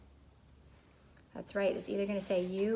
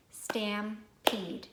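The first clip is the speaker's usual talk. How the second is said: "Stampede" split as stam, pause, peed; clapped syllables. Shown as a demonstration. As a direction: clap out "Stampede" in two beats. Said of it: "Can you clap it with me?".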